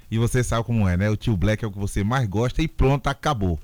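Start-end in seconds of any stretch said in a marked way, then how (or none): none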